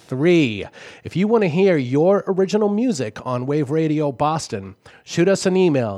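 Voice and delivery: radio DJ voice. Acted. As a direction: announce it like a DJ on air. Station ID and call-in numbers.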